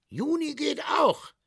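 high, loud fairground sales shout; hawker shouting pitch. Acted. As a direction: sell soap at full volume to the crowd. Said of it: "sagte der Verkäufer".

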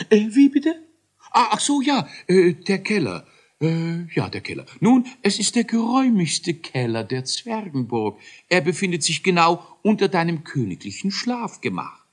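very high, loud stammering inventor; nervous dithering professor; flustered scientist. Acted. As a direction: caught out, fumbling for words. Kakeldei caught off guard.

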